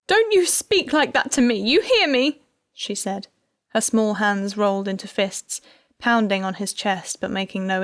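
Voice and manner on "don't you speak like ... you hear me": high, loud furious scolding. A narrator's reading. Wife pounding on husband's chest, furious. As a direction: give him a piece of her mind.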